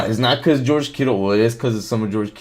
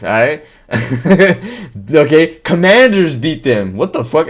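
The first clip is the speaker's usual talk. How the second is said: ordinary talk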